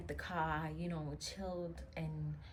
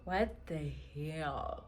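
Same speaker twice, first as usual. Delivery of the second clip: shocked disbelief; 8 semitones higher, 7 dB louder, slower than usual. Retold or imitated. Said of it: "I'm in shock".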